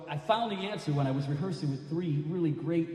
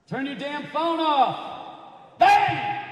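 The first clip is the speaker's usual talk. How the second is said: high, loud, drawn-out shout; exasperated yell; 13 semitones higher, 10 dB louder, much slower than usual. Pretend. Performer scolding an audience member's phone, yelling. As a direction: holler it slowly at the offender.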